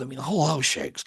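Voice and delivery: ordinary talk, nothing marked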